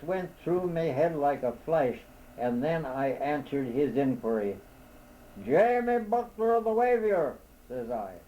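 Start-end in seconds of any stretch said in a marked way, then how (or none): 5.4-7.3 high, loud polite call. A narrator's reading. Lost man answering the owl overhead, high and loud. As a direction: answer up into the tree.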